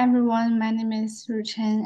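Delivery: ordinary talk, nothing marked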